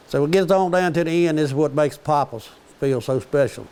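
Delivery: normal talking voice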